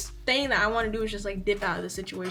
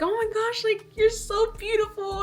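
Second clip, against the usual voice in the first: gushing aunt; 11 semitones higher than usual. Retold or imitated. Aunt gushes at reunion.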